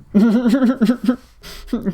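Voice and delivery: a loud real laugh at the clip; burst of laughter. Real, not acted. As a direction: laugh out loud, caught off guard.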